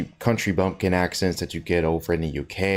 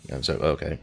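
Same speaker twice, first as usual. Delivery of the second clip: normal talking voice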